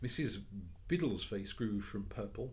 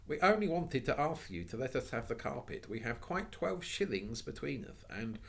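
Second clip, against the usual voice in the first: put-on polite girl's plea; 6 semitones higher, 4 dB louder than usual. Anthea pleading politely.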